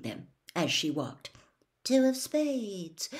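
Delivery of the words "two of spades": murmured. A narrator's reading. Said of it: "she murmured".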